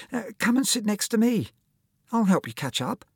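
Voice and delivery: put-on high, piping small boy; little schoolboy voice. Small boy helping his classmate, high piping voice. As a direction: offer help in a high little voice. Little Paul offers help.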